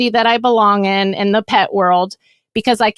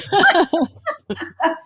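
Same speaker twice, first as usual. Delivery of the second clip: high bright burst of laughter; 6 semitones higher, 3 dB quieter than usual. Real, not acted. Co-host laughing at her own repeated point, high laugh. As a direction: laugh at your own insistence.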